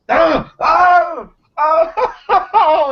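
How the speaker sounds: high, loud screams of pain. Acted. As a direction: scream as if blinded. Dacre blinded by flare.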